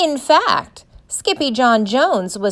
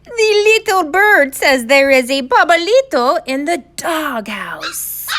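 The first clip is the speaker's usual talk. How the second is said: high, loud, put-on hero voice; excited kitten hero; 7 semitones higher, 5 dB louder than usual. A narrator's reading. Kitten pulls a bird from his ear, excited announcement. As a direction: proclaim it high and loud in character.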